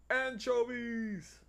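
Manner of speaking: high drawn-out cartoon yell; SpongeBob anchovies yell. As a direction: stretch it out like a cartoon. Quoting SpongeBob scene.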